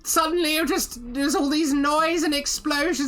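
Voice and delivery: high, rattled little companion voice; small high-voiced sidekick in a fluster; flustered grateful sidekick. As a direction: gabble in a high voice, shaken and grateful.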